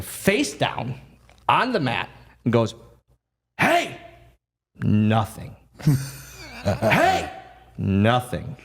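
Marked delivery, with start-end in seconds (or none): 3.6-4.0 high, sharp "Hey!" called to wake him; high call to a motionless friend. Retold or imitated. Fit calling to knocked-out wrestler. 6.6-7.3 high, sharp "Hey!" called to wake him; high call to a motionless friend. Retold or imitated. Fit calling again.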